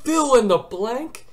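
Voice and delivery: high, slow, rising disbelief; shocked disbelief. Retold or imitated. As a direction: hear the question as if it insults you. Shocked at test format.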